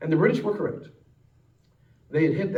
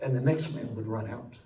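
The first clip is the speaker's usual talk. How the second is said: preacher lowering his voice for the sacrifice; hushed solemn sermon close; 3 semitones lower, 7 dB quieter than usual. Acted. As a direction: let it sink in quietly.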